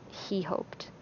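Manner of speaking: soft unvoiced whisper; whispered aside. A narrator's reading. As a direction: breathe it almost silently. Inner thought, whispered aside.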